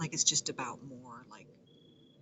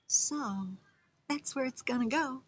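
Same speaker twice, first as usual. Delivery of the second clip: high put-on voice; 10 semitones higher, 5 dB louder than usual.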